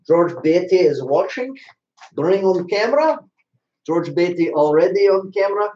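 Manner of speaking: hammed-up Russian accent, pitched high. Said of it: "not being able to stop using a Russian accent".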